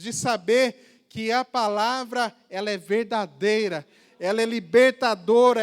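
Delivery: fervent preacher, swelling on key words; emphatic preaching. Acted. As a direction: hammer out each claim to the congregation. Preaches on, declaring the word.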